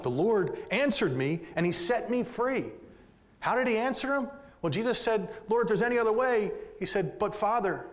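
impassioned pulpit voice; full-force preaching. Acted. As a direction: drive the verse home to the congregation.

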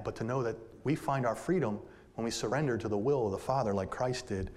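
ordinary delivery

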